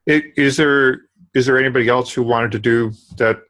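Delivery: ordinary talk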